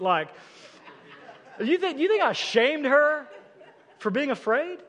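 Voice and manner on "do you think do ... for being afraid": high, louder, half-laughing disbelief; incredulous rhetorical question. Real, not acted. Pastor to congregation, incredulous and half laughing. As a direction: ask it as if the idea is absurd.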